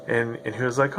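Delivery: ordinary talk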